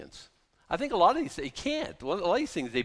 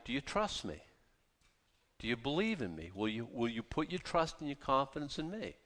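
soft, lower, earnest asking; gentle questioning; 3 semitones lower, 10 dB quieter than usual. Acted. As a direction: invite trust quietly. God gently asking for trust.

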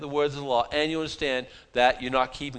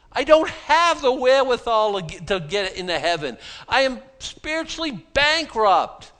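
higher, louder, slowing on bankrupt; broken sinner; 7 semitones higher, 4 dB louder than usual. Retold or imitated. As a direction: cry out your spiritual bankruptcy.

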